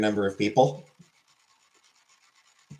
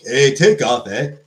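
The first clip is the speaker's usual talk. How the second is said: Bob and Doug McKenzie; 5 dB louder, slower than usual. Acted. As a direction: do a loud Canadian sketch-comedy "eh" voice. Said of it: "Bob and Doug McKenzie".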